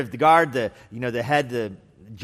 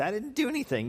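much higher, mock-innocent protest; lightly voiced baffled believer; protesting innocence; 11 semitones higher, 4 dB quieter than usual. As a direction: play the wronged believer, pitched way up.

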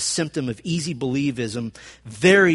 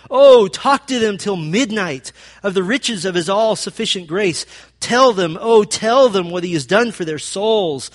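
rising, louder preaching that builds to full force; fervent preaching; 4 semitones higher, 4 dB louder than usual. Acted. Pastor reads Whitefield's charge to his congregation, fervent building preaching. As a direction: plead it like a revival preacher urging a friend.

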